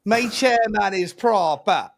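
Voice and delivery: a hint of a Welsh accent, drawn out; singsong Welsh accent, stretched words. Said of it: "my chairman in a Welsh accent".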